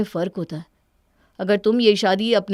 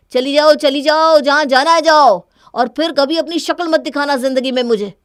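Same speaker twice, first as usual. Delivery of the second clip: loud, higher, driving her out; furious shout; 7 semitones higher, 8 dB louder than usual. Acted. Husband shouting at his leaving wife to go, furious. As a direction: bellow at her to get out.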